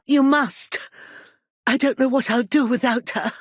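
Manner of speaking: urgent, high-pitched pleading; desperate plea. Acted. Mother begging for help to find her girl, desperate. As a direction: beg frantically, voice pushed up high. Mother begs for missing daughter.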